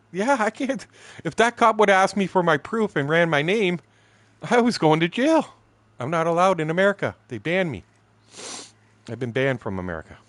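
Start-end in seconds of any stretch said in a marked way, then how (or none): 0.1-7.8 high, loud worked-up telling; agitated. Real, not acted. Teller realizes he could be jailed, high agitated telling. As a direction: rush it, worked up about jail. Panicking about being banned.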